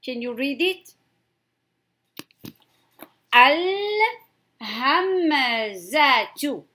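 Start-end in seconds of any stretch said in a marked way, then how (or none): 3.3-6.6 Al-hamzatu drawn out very slowly, ending in a clear -tu; full final ta. Shown as a demonstration. Teacher showing learners the word's full ending, Al-hamzatu said slowly with -tu. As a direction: sound the ta at the end of Al-hamzatu. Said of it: "if ta is in the end of the kalima".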